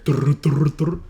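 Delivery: rolled "drr" mimicking quad drums; playful drum-roll imitation; imitated drum roll. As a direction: buzz your lips like marching drums. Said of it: "quad drums going in my ear".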